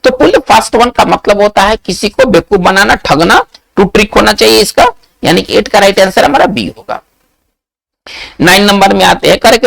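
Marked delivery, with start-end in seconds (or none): none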